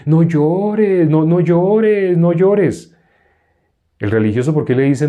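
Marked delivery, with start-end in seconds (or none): 0.1-3.0 higher, stretched singsong pleading; mock religious consoling. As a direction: parody a religious person's empty comfort. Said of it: "un religioso que dice".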